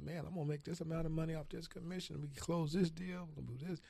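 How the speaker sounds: recalled giddy excitement; retold excitement. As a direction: pitch up, picture the big commission. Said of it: "I was excited".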